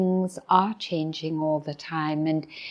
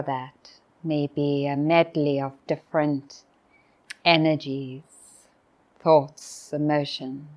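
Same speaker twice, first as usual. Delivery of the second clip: guided meditation; 2 semitones lower than usual. Real, not acted. Teacher inviting retreatants to be present at the closing morning, guided meditation. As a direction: invite them into stillness, with long pauses. Leads a reflective pause.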